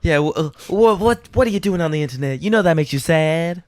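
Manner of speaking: high, scolding-yet-caring Southern wife; hammed-up syrupy concern. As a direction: fret at your husband in a diva voice. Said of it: "my [name] impersonation".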